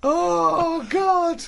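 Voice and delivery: high, drawn-out wail of amused despair; helpless exasperation. Real, not acted. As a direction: wail it, worn out from laughing. Overcome by laughter at the diary.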